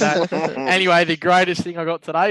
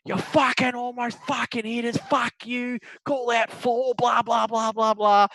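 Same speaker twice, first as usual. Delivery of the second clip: higher-pitched imitation of an angry golfer; mock ballistic rant; 6 semitones higher, slower than usual. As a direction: retell the golfer's tirade, half-hammed. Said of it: "going absolutely fucking ballistic on this bloke".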